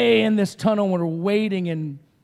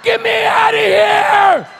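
very high, loud, drawn-out scream; imitated desperate scream; 13 semitones higher, 8 dB louder, slower than usual. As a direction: scream as if trapped in a casket. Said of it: "all we could hear was".

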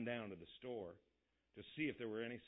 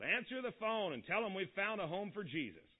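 yelled; 6 semitones higher than usual. A narrator's reading. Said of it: "Father yelled".

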